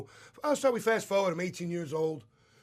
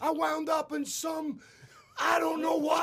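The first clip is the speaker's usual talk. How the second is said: exasperated shout; 9 semitones higher, slower than usual. Retold or imitated. Exasperated retelling of Florida move.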